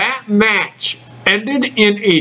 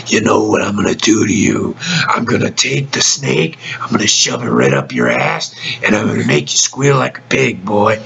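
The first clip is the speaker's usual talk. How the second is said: low menacing promo voice, much lower; Jake the Snake impression; 11 semitones lower than usual. Podcast host imitating Jake Roberts's low menacing promo. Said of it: "talking in that low-toned voice".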